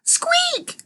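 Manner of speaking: very high, piercing squeak; screaming mouse squeak. A narrator's reading. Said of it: "screamed the mouse".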